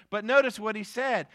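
ordinary talk

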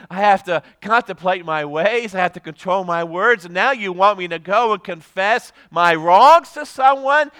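loud, rising mock disbelief; indignant protest. Church member objecting to pastor's demands, mock indignant.

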